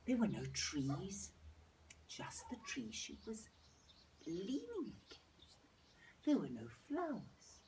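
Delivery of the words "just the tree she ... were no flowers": soft whisper. A narrator's reading. Narrator whispering about the lone tree. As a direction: hush the words.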